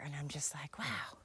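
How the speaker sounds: hushed, amazed whisper; awed whisper. Retold or imitated. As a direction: breathe it out in awe. Awed inner quote.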